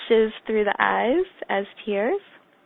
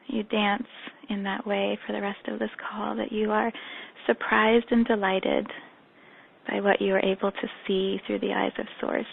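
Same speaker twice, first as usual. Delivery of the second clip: soft, slowed meditation guidance; guided meditation; 5 dB quieter than usual. Acted. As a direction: lead them gently into the practice.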